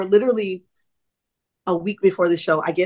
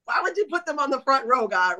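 higher, mock-desperate complaint to God; mock exasperated plea; 6 semitones higher, 4 dB quieter than usual. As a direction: playfully plead with God, pitched up.

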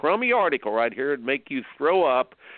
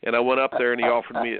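usual speaking voice

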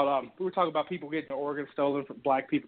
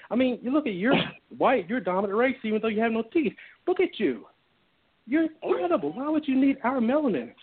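fawning sellout dude; 6 semitones higher than usual. Retold or imitated. Mocking a sellout who flatters a Klansman, fawning and higher. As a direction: pile on the fake praise, pitched up. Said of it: "that dude who's like".

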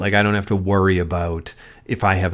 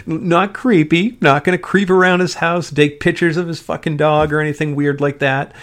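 defensive; 7 semitones higher, 3 dB louder than usual. Real, not acted. Denying being a creepy fan.

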